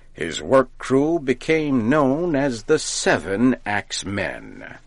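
usual speaking voice

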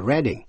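"Reading" said RED-ing like the colour red; "Reading" with short e, stress on RED; Reading said right. Shown as a demonstration. Teacher giving the English town name, RED-ing. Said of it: "đọc là Reading".

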